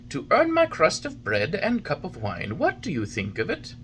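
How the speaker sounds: put-on an old craftsman pitching his dream; old man sharing his plan with a carpenter; Geppetto's eager voice. Geppetto tells his idea.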